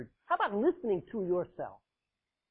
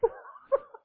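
mocking high giggle. Imitating girls' reaction.

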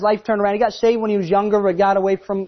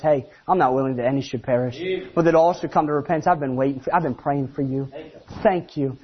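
Jesus voiced; 7 semitones lower, 3 dB quieter than usual. God voiced tenderly in sermon.

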